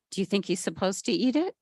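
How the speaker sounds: ordinary delivery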